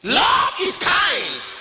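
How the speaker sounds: slow, drawn-out preacher's declaration; preaching. Acted. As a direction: proclaim each word slowly to the congregation.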